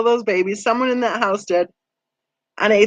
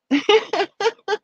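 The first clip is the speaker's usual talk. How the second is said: high giggle; 11 semitones higher than usual. Real, not acted. Laughs at own rambling.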